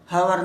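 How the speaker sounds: हावर starting on a loud, sounded H; wrong H sound. Shown as a demonstration. Teacher rejecting the slip, हावर with H voiced, loud. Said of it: "एच नहीं बोलता".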